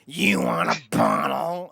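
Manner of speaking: slow, drawn-out put-on voice; awful-sounding parent. Other parent offering baby a bottle, slow mock-awful voice. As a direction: drag each word out in a comically awful voice. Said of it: "you're both the worst sounding".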